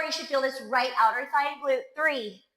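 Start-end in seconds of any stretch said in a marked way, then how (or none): none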